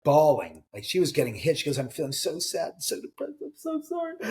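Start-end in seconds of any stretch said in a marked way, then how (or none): 1.8-4.2 higher, softer mock-tearful voice of the tour host; a light imitation of someone overwhelmed and weeping; imitating her crying. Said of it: "And she was crying".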